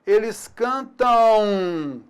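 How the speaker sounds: cantam drawn out very slowly; slow demo. Shown as a demonstration. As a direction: stretch every syllable of cantam. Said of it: "ela pode também produzir o som U".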